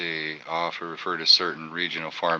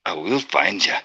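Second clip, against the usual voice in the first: mock movie-hero line; imitating movie threat. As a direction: echo a film threat playfully. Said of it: "a right good Liam Neeson impression".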